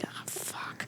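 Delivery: breathy whispered oh fuck; hushed dismayed whisper; whispered curse. Retold or imitated. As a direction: mutter it under your breath in dismay. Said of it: "I'd be like".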